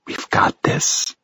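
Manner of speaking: slow, breathy whisper of reassurance. Acted. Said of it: "Rachel whispers".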